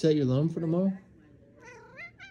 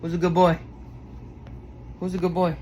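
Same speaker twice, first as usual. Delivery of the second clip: excited pet praise; 3 semitones higher than usual. Real, not acted. Owner gushing over his dog, excited praise. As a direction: hype the dog up like he's the best.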